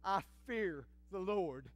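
ordinary talk, nothing marked

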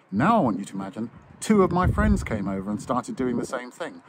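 ordinary talk

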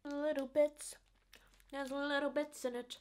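talking while chewing food; chewy muffled speech; mouth full, chewing. Real, not acted. Seen 1: bit bar at -4s; chewing mouth movements while talking early on.

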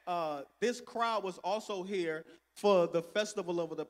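usual speaking voice

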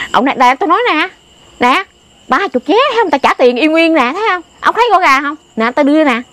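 high, insistent retelling of his words; excited retold quarrel; retold heated argument. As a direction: push the point hard, voice up high. Retold man arguing over lottery tickets.

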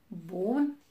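loud, incredulous single word; surprised wolf. A narrator's reading. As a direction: scoff at the very idea. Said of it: "s-a mirat lupul cel mare și rău".

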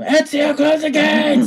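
very high, loud, laughing panic; panicked yell. Acted. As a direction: shriek it like he's lost all calm. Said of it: "Bart loses all his calm".

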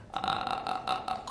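very low, slow creaky "ah"; dry vocal fry. Shown as a demonstration. Teacher explaining the term vocal fry, dry low croak. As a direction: let single dry pulses crackle out. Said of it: "乾いた音ね".

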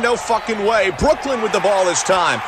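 normal talking voice

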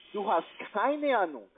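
ordinary talk, nothing marked

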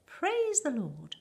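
high, loud, drawn-out rejoicing; slow, exultant high cry; jubilant yell. A narrator's reading. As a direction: stretch it out in a triumphant shout. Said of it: "yelled Grandpa Joe".

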